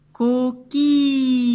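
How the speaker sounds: "Coquille" slow and loud with a long I; stretched vowel. Shown as a demonstration. Teacher making pupils hear I, "Coquille" stretched. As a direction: hold the I in "Coquille" high and loud. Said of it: "I, j'entends I".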